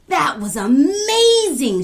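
high, loud, drawn-out cheer; excited shout. A narrator's reading. Kwesi cheering Kofi's finished story, excited shout. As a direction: shout it in sheer amazement. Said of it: "shouted Kwesi".

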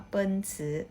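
"เปิ้นซือ" said as Mandarin ben-chi with a falling first syllable; "เปิ้นซือ" in Chinese tones; Mandarin reading. Shown as a demonstration. Teacher giving the Chinese name for Mercedes-Benz, Mandarin tones. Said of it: "คนจีนจะอ่านว่า".

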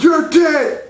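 Buzz Lightyear. Acted. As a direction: declare it as an outraged space hero. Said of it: "Buzz Lightyear's like".

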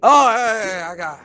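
loud, high burst of agreement; eager barkeep. Acted. Barkeep answering a customer asking for potions, eagerly loud. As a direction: start high and loud, falling as you go.

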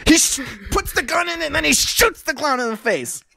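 high, shouted play-by-play; excited narration. Real, not acted. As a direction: shout out the big shot.

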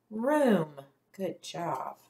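normal talking voice

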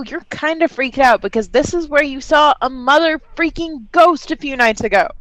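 ordinary talk, nothing marked

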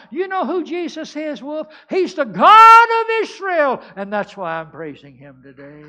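high preacher's shout, swelling to a long held cry; exultant revival roar; triumphant proclamation. Acted. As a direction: build to a full-voiced yell of praise. Climax of third acted testimony.

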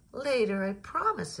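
high eager promise; pleading child. A narrator's reading. Jillian promises her mother, high and pleading. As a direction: pitch it up like a little girl begging.

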